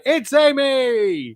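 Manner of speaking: high, long-drawn name reveal; singsong show-host welcome; announcer's introduction. Real, not acted. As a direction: bring on the co-host with a stretched cheer.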